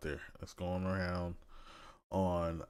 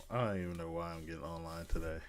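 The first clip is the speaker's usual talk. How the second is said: ordinary talk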